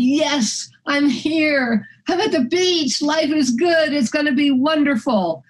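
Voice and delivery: high-pitched happy exclamations; excited delight. Retold or imitated. Dog owner thinking to herself on reaching the beach, delighted. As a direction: celebrate arriving, pitched well up.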